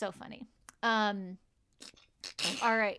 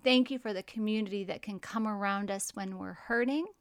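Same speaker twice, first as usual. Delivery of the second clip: prayer; 2 semitones lower, 4 dB quieter than usual. Acted. Prayer continues.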